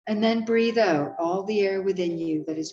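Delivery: calm guiding voice with long pauses; guided meditation. Acted. Reads a guided meditation over music.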